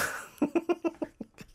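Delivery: a high-pitched burst of giggling; giggle. Real, not acted. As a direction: break into a short high giggle. Laughs while reading messages.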